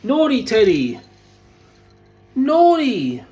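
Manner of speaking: high, loud telling-off of a naughty dog; scolding the dog. Real, not acted.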